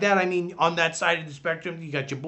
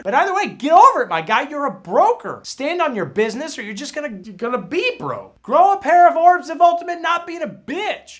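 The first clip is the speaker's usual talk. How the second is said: yelling; 7 semitones higher, 5 dB louder than usual. Acted. Yelling at the broker.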